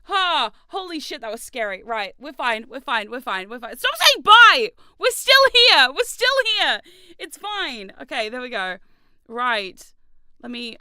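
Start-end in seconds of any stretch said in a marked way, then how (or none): 3.8-6.8 high, loud protest at chat; yelling at chat. Real, not acted. Streamer yelling at chat to stop saying bye. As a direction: shout it out in alarm.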